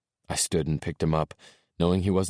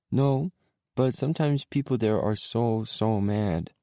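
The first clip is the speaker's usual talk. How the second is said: put-on high, eager little boy; 7 semitones higher, slower than usual. A narrator's reading. Small boy telling dad his toys are at mommy's, little boy voice.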